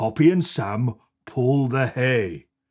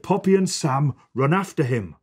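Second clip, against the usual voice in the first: ordinary delivery, nothing marked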